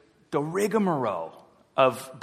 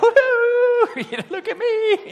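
high, loud whoop with a laugh; gleeful show-off; 5 dB louder than usual. Retold or imitated. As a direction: crow like a reckless kid showing off. Mock carefree person ignoring God.

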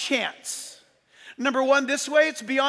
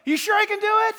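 showman teasing crowd; 5 semitones higher, 4 dB louder, faster than usual. Retold or imitated. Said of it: "And he goes".

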